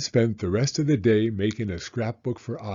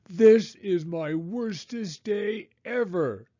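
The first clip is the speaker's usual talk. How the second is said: higher, slow, stretched whine; lost temper; 9 semitones higher, slower than usual. A narrator's reading. Angry Franklin at the roped-off pond, slow sulky tantrum. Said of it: "Franklin lost his temper".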